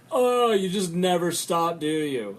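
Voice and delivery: loud, high, drawn-out mock exasperation; mocking relative. Relative mocks him for talking politics, hammed-up exasperation. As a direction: start on a big stretched groan, then ease off. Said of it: "mocking me for talking politics".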